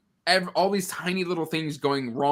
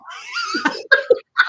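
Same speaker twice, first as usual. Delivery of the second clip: sudden high-pitched giggle; high laugh; 4 dB louder than usual. Real, not acted. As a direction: burst of high squealing laughter. Laughing at the absurdity.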